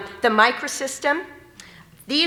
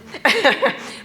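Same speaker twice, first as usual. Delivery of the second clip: embarrassed laugh; 6 semitones higher, 5 dB louder than usual. Real, not acted. Embarrassed laugh after tears.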